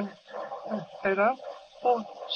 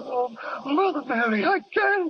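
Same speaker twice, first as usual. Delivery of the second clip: higher, breaking desperate cry; desperate plea; 8 semitones higher than usual. Acted. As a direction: beg in panic. Soldier begs not to return.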